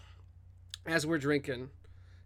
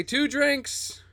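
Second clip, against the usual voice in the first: put-on Southern accent. Player's character ordering drinks from the bartender, Southern accent. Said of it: "I could do a Southern accent".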